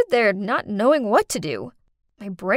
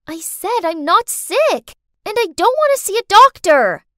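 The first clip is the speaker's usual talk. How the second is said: put-on upset child; 9 semitones higher, 8 dB louder than usual. Girl snapping at her dad about the doctor, higher and louder. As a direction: dig in like a cross little girl.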